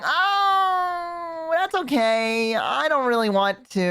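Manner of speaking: high, slow, awkward demurral; reluctant refusal. Acted. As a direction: squirm out of it with drawn-out words. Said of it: "I'm gonna be like".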